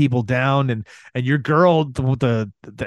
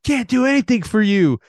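exasperated outburst; 11 semitones higher than usual. Retold or imitated. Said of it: "He's like".

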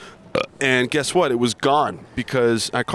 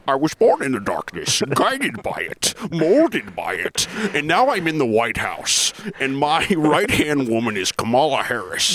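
Bane impression. Asked for a Bane impression, slow villain voice. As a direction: menace it out like Bane, breaking into laughs. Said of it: "Can you do a Bane impersonation?".

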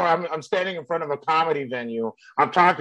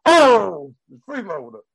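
Andrew; 4 semitones higher, 6 dB louder, slower than usual. Acted. Comic does an impression for co-host, loud brash comic voice. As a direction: belt out the "Oh", then toss off the word. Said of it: "let me do Andrew".

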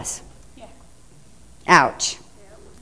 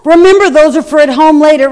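higher, louder "no, no, no"; scolding mom; 6 semitones higher, 6 dB louder than usual. Retold or imitated. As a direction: mom snapping at a kid. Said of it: "Mom's like".

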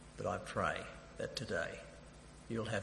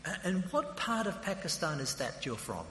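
high-pitched, intrigued enquirer; raised, curious voice; intrigued questioning; 8 semitones higher than usual. Retold or imitated. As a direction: act out keen interest in the driver. Acts out his interested questioning.

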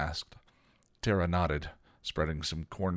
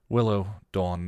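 plain reading voice

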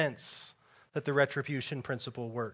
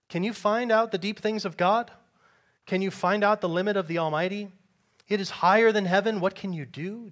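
higher, louder preaching read; scripture reading; 5 semitones higher, 5 dB louder than usual. Acted. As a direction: proclaim the verses. Reads scripture aloud.

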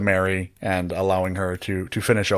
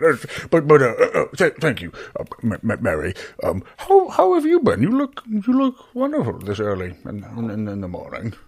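flustered Barry. Acted. Barry thanking Mary for wine, flustered and stammering.

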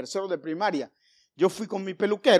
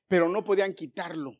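ordinary delivery

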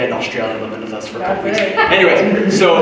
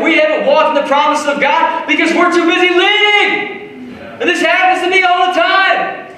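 preaching at full force; 13 semitones higher than usual. Acted. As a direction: preach it out, rising to a shout. Preaching rises in intensity.

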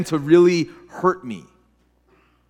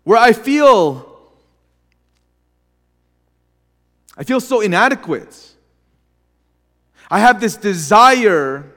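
retold anguished prayer; 3 semitones higher, 4 dB louder than usual. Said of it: "I remember thinking, like".

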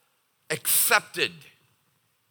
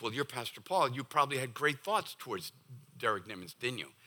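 hushed mock question; 4 semitones lower, 10 dB quieter than usual. Imagined listener teasing, quiet.

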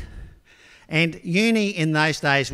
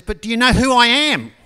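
much higher and louder, drawn up proud; indignant shout; 8 semitones higher, 11 dB louder than usual. Retold or imitated. Student rising to confront the professor, loud indignant shout. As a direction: puff yourself up and demand it. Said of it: "raised himself and says".

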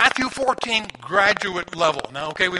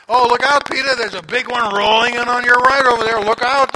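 higher, louder shout of warning; alarmed warning; 4 semitones higher, 7 dB louder than usual. Retold or imitated. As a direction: yell a panicky warning from the boat. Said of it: "Thomas over here says".

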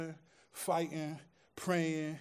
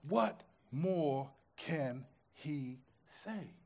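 hushed and slow; 2 semitones lower, 6 dB quieter, much slower than usual. Acted. After the shouting, preacher asks the church softly and slowly. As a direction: let it hang after the shouting.

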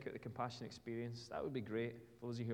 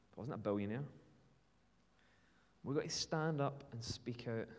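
ordinary talk

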